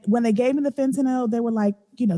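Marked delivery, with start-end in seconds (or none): none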